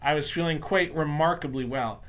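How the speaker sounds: ordinary delivery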